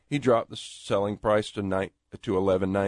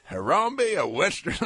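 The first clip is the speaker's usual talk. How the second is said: laughing; 8 semitones higher than usual. Real, not acted. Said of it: "That's about as far as I can carry".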